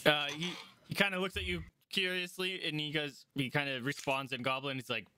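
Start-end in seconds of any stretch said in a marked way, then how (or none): none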